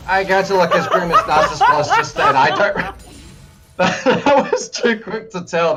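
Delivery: laughing while talking. Real, not acted. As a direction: talk through a surprised laugh. Co-caster laughs at surprise.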